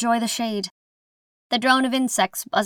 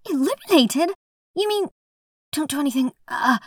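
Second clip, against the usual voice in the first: alarmed; 7 semitones higher than usual. Acted. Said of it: "the voice trails off".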